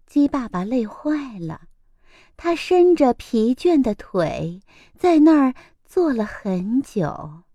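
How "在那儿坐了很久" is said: plain reading voice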